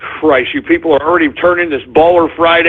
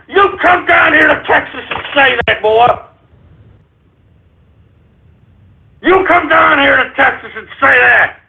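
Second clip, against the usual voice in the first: mocking taunt; 6 semitones higher than usual. Real, not acted. Host taunts Texas caller.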